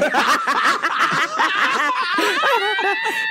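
big high-pitched burst of laughter; loud high cackle. Real, not acted. Cracking up at the cucumber revenge, loud high cackle. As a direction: let a loud cackle rip.

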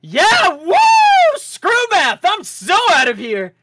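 high, loud whooping; excited cheer. Acted. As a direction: cheer as you run off. Leo cheering leaving.

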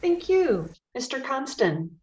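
ordinary delivery

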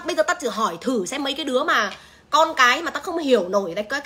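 ordinary talk, nothing marked

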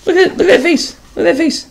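high, wide-eyed look at that face; amazed exclaim. Real, not acted. Narrator pointing at a picture, amazed and high.

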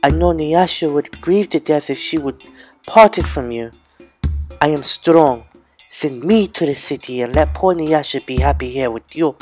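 high, sugary false sweetness; sweetly. A narrator's reading. Manyara to her father, falsely sweet and high. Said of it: "Manyara said sweetly".

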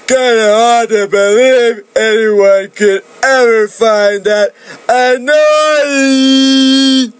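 lightly voiced young man in awe; Augustus, wondering. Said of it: "Augustus said".

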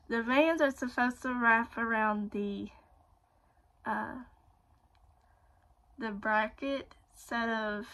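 talking with mouth stretched wide; lips pulled open by fingers. Real, not acted. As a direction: fingers hooked in mouth corners. Seen 1: Fingers hook and pull lip corners apart, showing braces, while talking.

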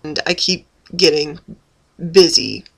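normal talking voice

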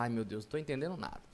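fast, low, soft mock lament; hurried mutter of a lost student. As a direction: rattle it off quietly as a confused pupil. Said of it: "Você vai falar".

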